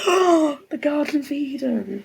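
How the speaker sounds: gasped, high and breathy amazement; mock awe. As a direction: act dazzled by your own idea.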